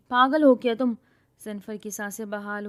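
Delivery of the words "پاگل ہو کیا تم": loud scolding snap; loud exasperated rebuke. Acted. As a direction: tell him off sharply, loud. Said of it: "ہاتھ میں ڈنڈا لیے کھڑی بول رہی تھی".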